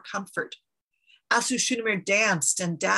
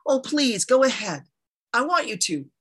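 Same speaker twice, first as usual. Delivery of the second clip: higher, pressing hospitality; insistent offer; 4 semitones higher, 4 dB louder than usual. A narrator's reading. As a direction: urge the guest to accept. Queen presses gift on polite refuser.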